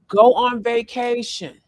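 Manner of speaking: slow, loud "Go on vacation" with weight on Go; emphatic command. Shown as a demonstration. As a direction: lean on "Go" and drag "Go on vacation". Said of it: "have to say it like that".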